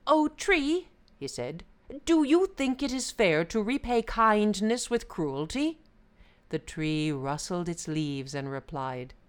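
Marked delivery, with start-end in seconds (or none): 0.1-0.9 high, loud hail; calling out. A narrator's reading. Brahmin addressing a tree, calling out. 2.1-5.8 high, loud earnest question; appealing Brahmin. A narrator's reading. Brahmin seeking the tree's opinion, appealing.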